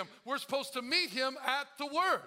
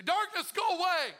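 loud, high shout at the darkness; commanding shout; 6 semitones higher, 4 dB louder than usual. Retold or imitated. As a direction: order the darkness away with force. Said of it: "he didn't say".

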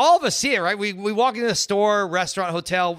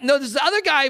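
excited; 9 semitones higher, 6 dB louder, faster than usual. Retold or imitated. Excited retold reply.

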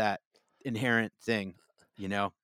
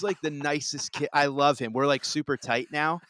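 normal talking voice

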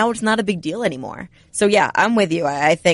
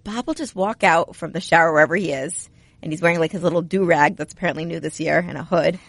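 ordinary talk

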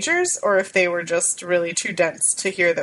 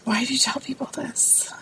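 whisper; 6 semitones higher, 4 dB quieter than usual. Real, not acted. Guest protests host revealing hugs.

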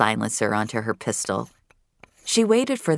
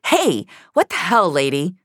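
louder, higher outraged protest; startled anger; 5 semitones higher, 4 dB louder than usual. A narrator's reading. Darius protesting at Finch, loud and outraged. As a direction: bark at the woman blocking the door. Startled, angry at blocked door.

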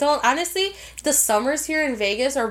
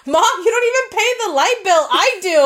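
loud, higher-pitched mock indignation; acted-out annoyed protest, voice raised; mad sister; 7 semitones higher, 5 dB louder than usual. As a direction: imitate an exasperated sister scolding her mother. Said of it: "my sister gets mad".

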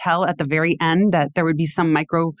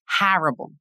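a hint of a New Jersey friend's "horrible"; New Jersey accent. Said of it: "in her New Jersey accent".

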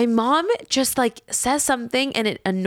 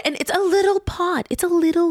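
ordinary talk

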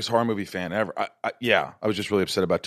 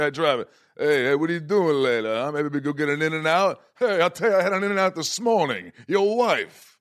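higher, mumbled tough-guy Stallone voice; Stallone impression; 6 semitones higher than usual. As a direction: do a Stallone talking to Rodney. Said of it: "Sylvester Stallone would happen".

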